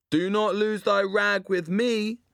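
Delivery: high, slow and grandly theatrical; mock theatrical. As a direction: lay on the stage pomp. Lloyd mocks in Shakespearean speech.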